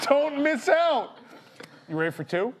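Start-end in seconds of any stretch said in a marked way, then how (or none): 0.0-1.2 high, loud, slow announcer ad line; hammed-up announcer. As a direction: oversell it like a pushy commercial. Mock reading of a pizza ad.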